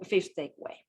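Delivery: ordinary talk, nothing marked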